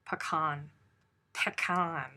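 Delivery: "pecan" tried out as puh-KAHN; trying foreign pronunciation. Shown as a demonstration. Testing the word in her mouth, "pecan" as puh-KAHN. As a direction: isolate "pecan" with a drawn KAHN ending. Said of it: "that sounds too frou-frou".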